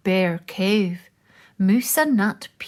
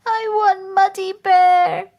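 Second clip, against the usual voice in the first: crying; 11 semitones higher, 6 dB louder than usual. Acted. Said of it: "cried Moose".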